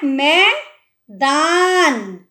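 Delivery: मैदान drawn out, very slowly; slow sounding out. Shown as a demonstration. Teacher sounding out मैदान, very slow. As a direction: stretch each syllable of मैदान. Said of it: "क्या word है".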